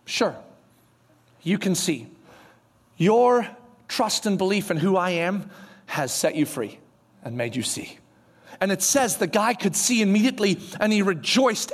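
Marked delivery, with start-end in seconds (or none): 0.0-8.0 soft, low, unhurried pronouncement; gentle Jesus. Retold or imitated. Jesus granting sight to the blind man, gentle. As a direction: grant it quietly, with pauses, sinking lower. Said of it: "Jesus goes like this".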